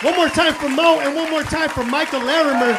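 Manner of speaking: loud, high crowd send-off; announcer sign-off. Real, not acted. Comic thanking the Chicago crowd, loud. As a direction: pump the crowd as you leave.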